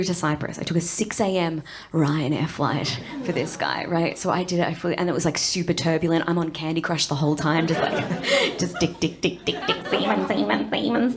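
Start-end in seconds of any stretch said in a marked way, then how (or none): none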